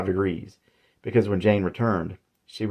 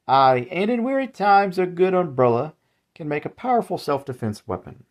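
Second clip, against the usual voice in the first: put-on old salesman boasting; 9 semitones higher than usual. Old man boasting of umbrella.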